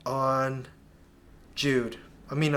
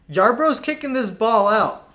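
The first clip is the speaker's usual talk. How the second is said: excited call; 7 semitones higher, 8 dB louder than usual. Real, not acted. Coach excitedly narrating a replay.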